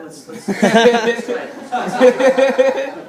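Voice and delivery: slow, loud, laughing urge; excited. Retold or imitated. Urging his friend Raymond to try again, excited and laughing. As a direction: drag it out, laughing, all wound up.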